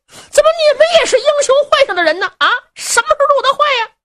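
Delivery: fast, very high, shocked magistrate; 惊慌质问. Acted. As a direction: blurt it out high and loud in alarm. 县太爷发现手下入会，惊问. (惊慌失措地尖声追问)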